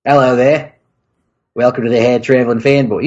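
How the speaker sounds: put-on New Zealand greeting; New Zealand accent. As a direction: imitate a New Zealand podcast host. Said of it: "your New Zealand accent".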